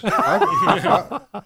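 squeaking up with a laugh. Real, not acted. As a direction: tease through laughter. Laughing while teasing.

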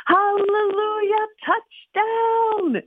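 high, slow triumphant cheer; cheering scream. Acted. Boy leaps around the living room cheering, high and loud. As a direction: crow it like he scored a touchdown. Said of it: "he screamed".

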